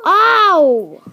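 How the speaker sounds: high, loud drawn-out cry of pain. Real, not acted. Hurt while getting up to go, loud yelp.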